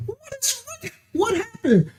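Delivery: high, shocked squeak; high-pitched retold disbelief. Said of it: "I said".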